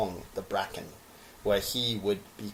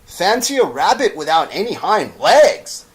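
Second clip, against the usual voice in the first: higher, louder jeer; mocking; 8 semitones higher, 10 dB louder than usual. Wild rabbit jeering at the toy rabbit, mocking. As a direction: ridicule him.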